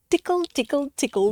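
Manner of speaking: plain reading voice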